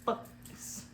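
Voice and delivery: hushed, voiceless curse; whispered swearing. Acted. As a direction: mouth the curse under your breath. Acted quote, swear word hushed.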